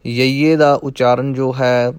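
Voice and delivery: ordinary talk, nothing marked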